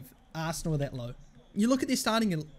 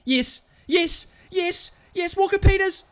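yeses climbing to a high loud shout; real rising, thrilled yelling; mounting excitement; 12 semitones higher, 7 dB louder than usual. Real, not acted. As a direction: urge the striker on as the chance builds. Urging player on towards goal.

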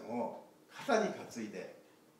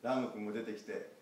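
plain reading voice

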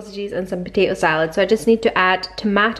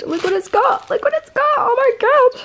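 excited. Real, not acted. Vlogger finding something inside the glass, squealing with excitement. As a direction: build the thrill as you repeat it.

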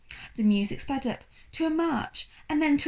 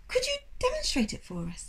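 polite request; 14 semitones higher, 3 dB quieter than usual. Acted. Charles asks politely.

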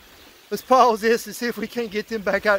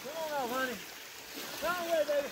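much softer, tender coaxing; 9 semitones higher, 13 dB quieter, much faster than usual. Real, not acted. Angler gently coaxing the catfish off the hook, soft and tender. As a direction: coax it gently like calming a pet.

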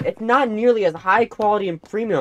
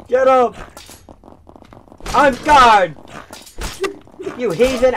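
loud mock-heroic battle cry; battle yell; 3 semitones higher, 6 dB louder than usual. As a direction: bellow it like a knight. Fighting in game, yelling.

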